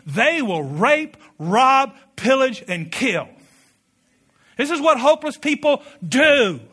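preacher climbing to full force; rising preaching shout. Real, not acted. Preacher building to climax.

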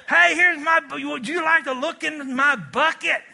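high, loud, eager salesman offering his bucket; hammed-up eager oversharer; eager pitch. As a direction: push your sad story on them cheerfully. Acting out a sad-story seller.

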